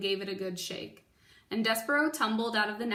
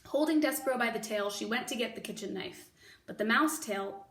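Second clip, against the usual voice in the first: usual speaking voice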